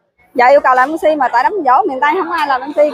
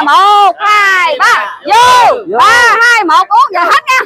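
loud, high count to drink; cheering count to the shot; shouted toast countdown; 7 semitones higher, 4 dB louder, much slower than usual. Real, not acted. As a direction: pump up the guests for the toast.

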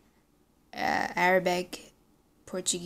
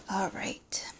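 whispered aside; 6 dB quieter than usual. Real, not acted. Muttered aside before explaining.

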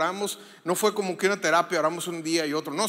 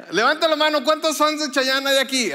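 louder and higher, calling out to the crowd; rallying call; 6 semitones higher, 6 dB louder than usual. Shown as a demonstration. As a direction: pump up the congregation. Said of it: "No, no dije que gritara". (llamado animado, más fuerte y agudo)

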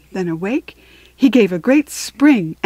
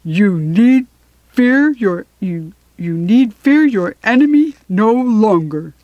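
loud, proud lion voice; 5 dB louder than usual. A narrator's reading. Lion reporting victory to the waiting beasts, loud and proud. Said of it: "said proudly".

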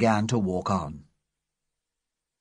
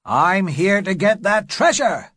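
louder and higher, bold; raised defiant declaration; defiant shout; 8 semitones higher, 5 dB louder than usual. Acted. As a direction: shout it to drown out the fear. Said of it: "shouted Silver".

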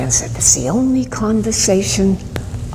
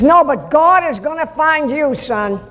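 high, calling voice without real volume; retold yell; 9 semitones higher than usual. Priest to the student leaving, imitated high-pitched call. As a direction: call it after a departing student. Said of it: "I yelled after the kid".

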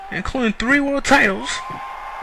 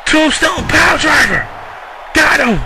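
excited shout; 4 semitones higher than usual. Real, not acted. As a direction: belt out the finisher. Calling the finishing move.

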